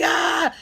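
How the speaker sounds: loud, high re-enacted shriek; panicked scream. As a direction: cry out in mock panic. Calls nurse for help in panic.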